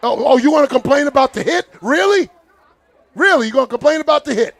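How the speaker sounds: real sarcastic taunt; mocking scorn. Real, not acted. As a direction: scoff at their complaint with a laugh. Mocking complaint about the hit.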